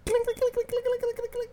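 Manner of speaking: high, rapid clinking noises; high metallic clink imitation; imitated clinking. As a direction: rattle off high-pitched clinks.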